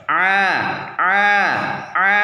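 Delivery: A squeezed from a tight mid throat; ain demo. Shown as a demonstration. Teacher demonstrating the letter ain with a tight, squeezed throat A. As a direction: strain the A through a narrowed middle of the throat. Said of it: "kalau ain di atasnya".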